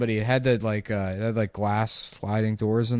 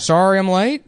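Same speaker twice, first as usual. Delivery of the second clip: high, loud apology with rising end; high-pitched manager impression; 10 semitones higher, 7 dB louder than usual. Manager turning up late for work, high mocking impression.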